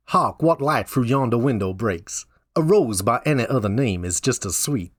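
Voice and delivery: Shakespeare in a put-on southern accent; hammy southern US accent. Shown as a demonstration. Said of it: "do it in a southern accent".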